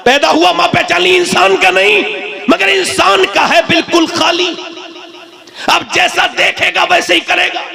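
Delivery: high, sustained declamation; impassioned preaching. Acted. Preacher warning that children copy their mothers, high impassioned declamation. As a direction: warn the crowd in a high pulpit voice.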